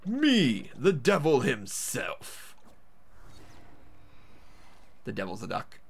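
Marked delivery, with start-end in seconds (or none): 0.1-2.6 grand villain reveal, slow; devil voice. Acted. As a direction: announce yourself as the devil, slow and proud.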